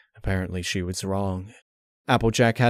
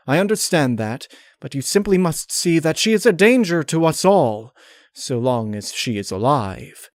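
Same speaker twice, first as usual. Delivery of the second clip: a touch higher, silky condescending lady; genteel pony voice, a hint of menace; lightly voiced Rarity; 5 semitones higher, 4 dB louder than usual. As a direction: patiently explain as a cold refined mare. Rarity's voice in story.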